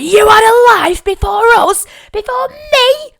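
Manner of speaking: high, loud outraged cry; indignant shriek. Retold or imitated. Child shrieking at dad over his secret past.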